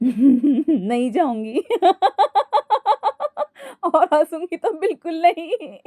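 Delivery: long high cackles around a drawn-out promise; laughing. A narrator's reading. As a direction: promise while laughing helplessly. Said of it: "हंसते हुए अंकिता बोली". (हंसते हुए)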